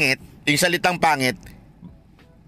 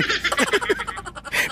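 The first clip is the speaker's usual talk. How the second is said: short high giggle; real amused giggle; high laugh; 4 dB quieter than usual. Real, not acted. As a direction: giggle lightly. Laughing during debate.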